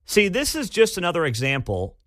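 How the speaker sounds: ordinary delivery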